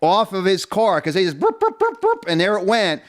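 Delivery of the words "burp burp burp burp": high, rapid "burp, burp" mimicking the impact wrench; imitated air gun. Pit crew gun zipping lug nuts off, imitated high and quick. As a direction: pop the lug nuts off with your voice.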